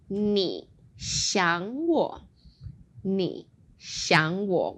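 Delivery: 你想我 said very slowly, each tone held; slow model phrase. Shown as a demonstration. Teacher models you-miss-me phrase slowly for student. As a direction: draw out 你想我 for the learner. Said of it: "I've also got".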